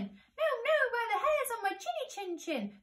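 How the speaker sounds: high, squeaky little-pig voice; defiant little pig. Acted. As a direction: squeal it out like a tiny piglet.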